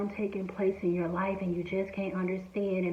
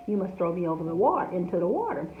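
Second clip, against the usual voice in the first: ordinary talk, nothing marked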